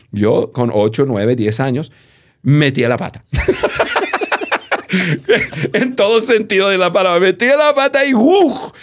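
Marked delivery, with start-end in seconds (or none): 3.3-5.7 high, open laugh at his own joke; burst of laughter. Real, not acted. Man laughing at his childhood mishap to the host, high laugh. 5.7-8.7 words spilling out through laughter, pitched high; laughing. Real, not acted. Man telling the host his icy mishap, laughing.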